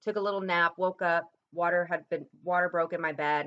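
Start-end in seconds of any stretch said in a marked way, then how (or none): none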